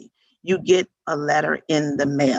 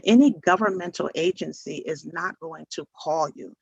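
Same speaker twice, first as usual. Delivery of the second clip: ordinary talk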